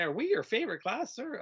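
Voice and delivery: put-on high, eager kid's question. Pupil asking the teacher, high eager kid voice. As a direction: ask it like a keen pupil hoping for yes. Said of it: "if they'd say".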